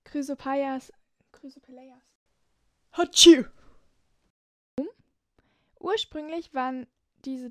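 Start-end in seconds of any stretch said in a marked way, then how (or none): 1.3-2.0 whispering. Real, not acted. Mumbled retry of a name. 2.9-3.5 loud sudden sneeze; big explosive sneeze; sneezing. Real, not acted. Outtakes full of sneezes.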